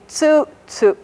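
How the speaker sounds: first tsu high, second tsu low; high then low pitch. Shown as a demonstration. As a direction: demonstrate tsu tsu stepping down in pitch. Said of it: "This one is high and this one is low".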